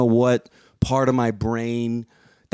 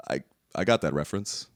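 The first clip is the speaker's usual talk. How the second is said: Captain America; 4 semitones lower, 5 dB quieter than usual. Retold or imitated. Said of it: "I really felt like Captain America".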